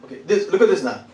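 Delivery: usual speaking voice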